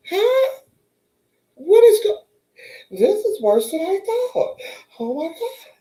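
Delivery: very high startled huh then dismay; high squeal of disbelief; shocked. Retold or imitated. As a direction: let the shock hit hard. Told they are keeping her.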